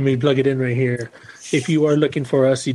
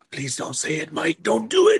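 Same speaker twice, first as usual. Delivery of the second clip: mock pleading; 3 semitones higher than usual. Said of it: "both of y'all were just like".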